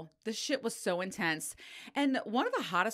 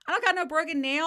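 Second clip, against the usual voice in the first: high, loud protest; indignant denial; 10 semitones higher, 7 dB louder, faster than usual. Retold or imitated. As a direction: push back in disbelief, voice raised. Acted denial to nail tech.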